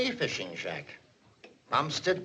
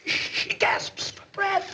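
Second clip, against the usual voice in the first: slow, higher, breathless whisper; hammed-up whisper; 14 semitones higher, much slower than usual. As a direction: play the suffocating girl. Dramatizing a fire scene.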